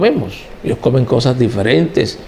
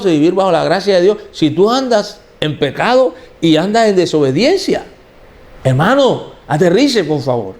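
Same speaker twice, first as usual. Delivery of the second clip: impassioned preaching; 5 semitones higher than usual. Acted. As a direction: preach high and urgent, pressing the point. Predica a toda fuerza. (predicación apasionada en tono alto)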